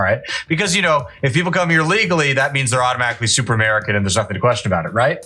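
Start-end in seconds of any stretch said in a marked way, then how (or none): none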